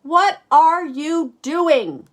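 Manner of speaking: high, loud, slow outraged yell; Arthur yells. Acted. Arthur yells at Max painting on him, slow loud high yell. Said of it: "yells Arthur".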